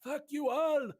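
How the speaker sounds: very high, loud cartoon reindeer voice; squeaky high-pitched foul-mouthed reindeer; Metzen the reindeer voice. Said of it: "practicing my own Metzen the reindeer voice".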